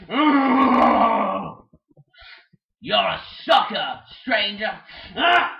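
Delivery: loud, furious cartoon quarrel; angry shouting. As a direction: yell insults at them. Birds quarrelling loudly.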